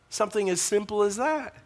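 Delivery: ordinary delivery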